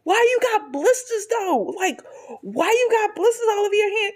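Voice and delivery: high-pitched indignant questioning; imitating an outraged mother's interrogation; mad mom. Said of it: "she was so mad".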